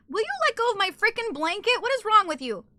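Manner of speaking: high-pitched pet scolding; exasperated scolding. Real, not acted. Scolding pet pulling her blanket.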